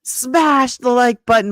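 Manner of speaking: loud, high, slow punch on "smash"; imitating her son. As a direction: belt out the slogan like your son. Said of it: "my son says".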